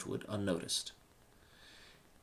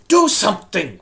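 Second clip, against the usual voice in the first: much louder, higher frantic cry; 12 semitones higher, 18 dB louder than usual. Acted. Reuven cries out as sirens attack, loud and frantic. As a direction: yell it in panic. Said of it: "Reuven cried".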